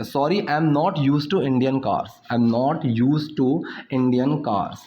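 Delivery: slow, careful English example sentence; slow teaching demo. Shown as a demonstration. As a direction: spell it out slowly for the learners. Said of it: "मुझे भारतीय कारों की आदत नहीं है". (धीमा, साफ़ उच्चारण)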